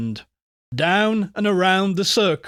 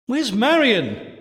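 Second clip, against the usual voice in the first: raised-pitch worried call; 7 semitones higher than usual. A narrator's reading. Louise to everyone in the cellar, calling out. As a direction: call across the room in a higher voice. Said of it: "called Louise".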